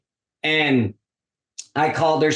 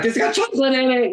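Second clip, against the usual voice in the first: mock alarm; 10 semitones higher, faster than usual. Scolding naughty puppy.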